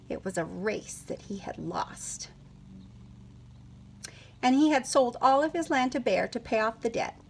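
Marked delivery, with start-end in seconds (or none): 0.1-2.3 soft unvoiced whisper; whispered aside. Storyteller whispers an aside to the children, hushed. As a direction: lean in and whisper it like a secret.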